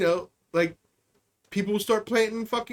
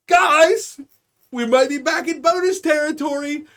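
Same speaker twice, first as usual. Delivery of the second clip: higher and much louder, thrilled cry; giddy excitement; 6 semitones higher, 5 dB louder than usual. Retold or imitated. Boardroom executive telling colleagues good news, giddy and loud. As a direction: announce the windfall with glee. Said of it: "they're just like".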